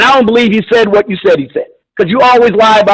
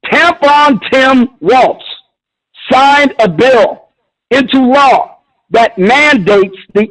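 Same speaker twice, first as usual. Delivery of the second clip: slow emphatic repeat; slower than usual. Real, not acted. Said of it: "let me say again what I just said".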